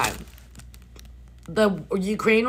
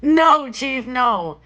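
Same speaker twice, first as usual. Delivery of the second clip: loud protest; 5 semitones higher, 6 dB louder, much slower than usual. Real, not acted. Reacting to iguana size.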